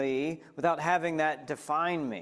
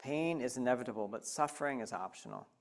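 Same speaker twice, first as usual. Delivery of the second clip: softer, lower and quicker, as if to oneself; quiet repeat; 4 semitones lower, 7 dB quieter than usual. Shown as a demonstration. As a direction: murmur "Pain is inevitable" back, lower and softer.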